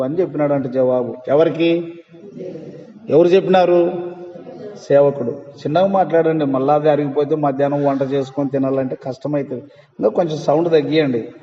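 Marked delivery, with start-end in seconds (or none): none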